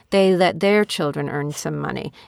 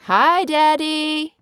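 high, slow sing-song hello; calling out; 8 semitones higher, much slower than usual. Real, not acted. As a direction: call up to Daddy across the yard. Greeting Daddy through the window.

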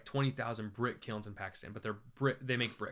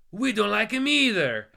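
slow, much higher, louder soldier voice; soldier's blurt; 9 semitones higher, 10 dB louder than usual. Retold or imitated. Soldier confiding to the traveler, a slow loud higher voice. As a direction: boom the confession out.

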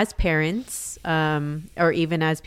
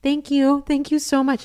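high, gushing retold thanks; raised-pitch, louder gratitude; effusively grateful; 9 semitones higher, 5 dB louder than usual. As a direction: imitate a relieved mom thanking someone profusely. Retelling the mom's grateful reply.

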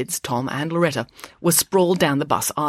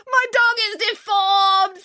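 very high, slow sobbing wail; cling to the dog and wail; 3 dB louder, slower than usual. Acted. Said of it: "April didn't stop wailing".

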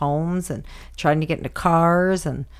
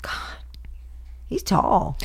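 awed whisper; 4 dB quieter, much slower than usual. Real, not acted. Marvelling at boyfriend's height.

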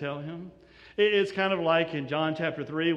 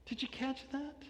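softer repeat; 7 semitones higher, 11 dB quieter than usual. Shown as a demonstration.

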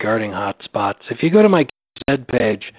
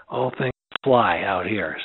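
usual speaking voice

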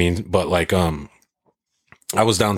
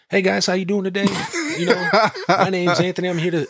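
much higher, bright salesman pitch; 12 semitones higher, faster than usual. Retold or imitated. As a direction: greet customers like an eager rep. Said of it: "I put my sales voice on".

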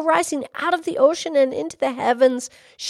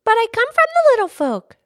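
put-on little girl's high, loud protest; crying out; 9 semitones higher, 7 dB louder, faster than usual. A narrator's reading. Little girl protesting to the horse, crying out. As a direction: cry it out high and insistent. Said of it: "she cried".

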